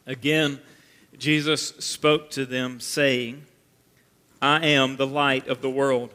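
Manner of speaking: solemn scripture reading. Acted. Reads scripture verse aloud.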